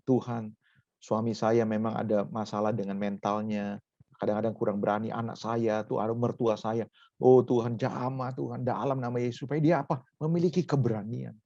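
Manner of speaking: low, hushed plea to God; demonstrated prayer. Shown as a demonstration. Models a wife's prayer. (doa istri dengan suara rendah dan lembut)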